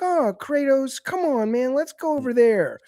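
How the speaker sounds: put-on high, loud whiny boy voice; mock Deimos as a chirpy kid sidekick. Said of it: "Deimos is like".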